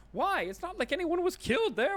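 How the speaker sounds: a jittery shop lady begging you to stop; panicked shopkeeper. Acted. Shopkeeper blurts out.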